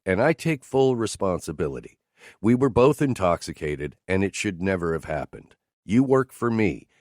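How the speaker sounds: ordinary talk, nothing marked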